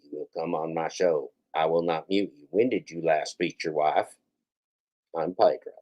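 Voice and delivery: Pedro impression. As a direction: drop the voice low and quiet to poke fun at him. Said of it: "this is my Pedro impression".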